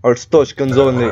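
mock Russian guard accent; terrible Russian accent. Inspector refusing a Kalechian entry, terrible Russian accent. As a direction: say it in a badly faked Russian accent. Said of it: "I love doing this accent".

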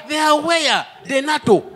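high, loud hype-man shouting; hyped shout. Acted. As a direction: pump up the crowd with each name. Hyped list of US responders.

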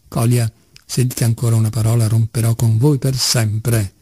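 plain reading voice

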